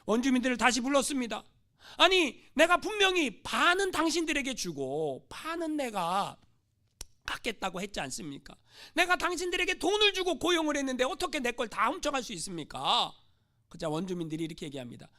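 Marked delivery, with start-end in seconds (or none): 2.0-13.1 loud, high indignant scolding; missionary scolds the natives, angry and loud; angry reproach. Acted. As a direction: scold them for breaking the deal. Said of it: "선교사님 화가 났어요".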